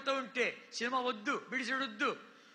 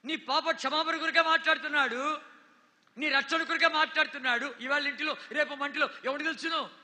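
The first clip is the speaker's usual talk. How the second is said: loud, urgent sermon delivery; fervent pulpit preaching; full-force preaching; 3 semitones higher, 5 dB louder than usual. Acted. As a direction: preach it hard to the crowd. Preaching over the congregation.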